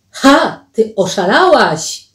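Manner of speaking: high, loud burst; outraged exclamation. Acted. Paweł tells Alicja she is mad, in loud outrage. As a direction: burst out in shocked protest.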